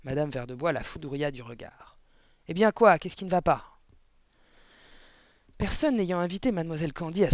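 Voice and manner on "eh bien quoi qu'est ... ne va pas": loud, higher, irritated question; brusque, raised challenge; irritated demand. A narrator's reading. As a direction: snap it at an unwelcome visitor. Glaring wife snaps at the visitor.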